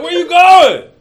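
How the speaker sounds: loud, high shout after someone; high shouted call. Retold or imitated. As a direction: yell after a friend who ran off. Friend calls after him.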